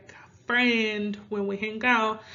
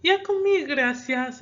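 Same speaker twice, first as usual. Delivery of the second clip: polite refusal; 6 semitones higher, 5 dB louder than usual. Shown as a demonstration. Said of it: "no thank you".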